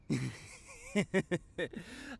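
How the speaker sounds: a light giggle at his own cheesy line. Real, not acted. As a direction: giggle at the joke. Laughs at math pickup line.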